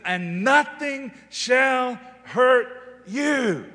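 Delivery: loud, high, ringing sermon voice; shouting preacher at the peak; full-force preaching. Acted. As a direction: preach it out to the whole room.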